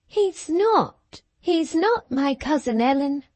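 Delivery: high, distressed denial; mild grief. A narrator's reading. Cathy clings to Ellen, high grieving denial. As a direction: deny it through grief. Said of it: "gathering fresh grief".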